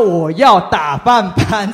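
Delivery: slow, loud, sure of being in the right; self-righteous. Retold or imitated. To the girls, claiming the meal duty, loud and self-righteous. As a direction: claim the duty as if it's owed you. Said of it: "很理直气壮说".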